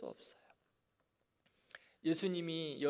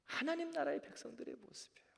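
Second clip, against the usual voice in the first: ordinary talk, nothing marked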